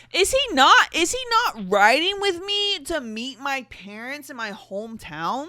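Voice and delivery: loud, high outraged disbelief; indignant rant. Real, not acted. Ranting at the cringe story she is reading, loud and outraged. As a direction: shout it at the absurd plot.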